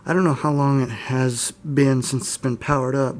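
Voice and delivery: ordinary delivery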